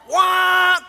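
angry driver yell. Retold or imitated. Driver yelling at a car swerving into his lane, loud and high. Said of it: "We're yelling, we're screaming".

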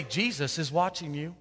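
low, soft, eerie voice in the dark house; haunt the burglar from the shadows; mysterious voice. Acted. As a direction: robber hears a hidden voice, low and eerie. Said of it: "he hears this voice say".

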